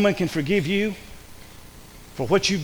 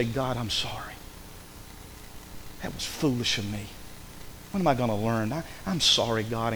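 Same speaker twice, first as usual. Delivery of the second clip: lower, softer contrite apology; remorseful prayer; 6 semitones lower, 10 dB quieter than usual. Retold or imitated. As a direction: confess it quietly to God. Driver's remorseful prayer after crash.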